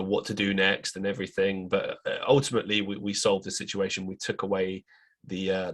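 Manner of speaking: ordinary talk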